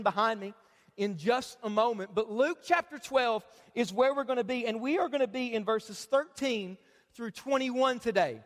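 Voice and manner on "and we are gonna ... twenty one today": ordinary talk, nothing marked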